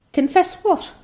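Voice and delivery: curt question pitched high; sharp old lady. Acted. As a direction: bite off the question.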